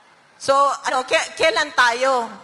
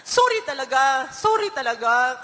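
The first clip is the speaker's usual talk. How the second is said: retelling a tearful apology to her daughter, choked up; a catch of tears in the voice; slower than usual. Retold or imitated. Mother retelling her tearful apology to her daughter, choked up. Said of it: "umiiyak na ako".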